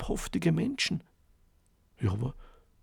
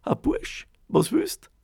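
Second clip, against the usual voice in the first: croaking bird; 9 semitones higher, 4 dB louder than usual. A narrator's reading. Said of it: "da hat er gleich gerachtzt".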